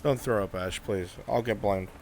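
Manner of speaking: normal talking voice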